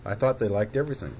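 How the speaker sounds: ordinary delivery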